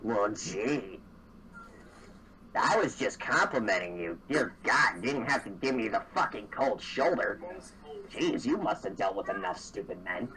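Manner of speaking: low, slightly wounded grumble; sulky mimic. Game master plays rebuffed mimic.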